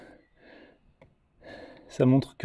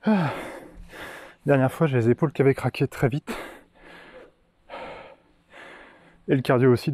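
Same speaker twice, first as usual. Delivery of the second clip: panting between words after push-ups; winded, breath audible on every phrase. Real, not acted. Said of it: "ça s'entend".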